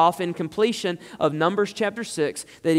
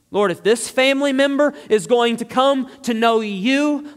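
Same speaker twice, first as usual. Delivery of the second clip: raised, urgent pleading prayer, louder and higher; fervent preaching; 5 semitones higher, 4 dB louder than usual. Acted. Preacher praying aloud for a family member to repent, fervent and raised. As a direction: plead with God from the pulpit.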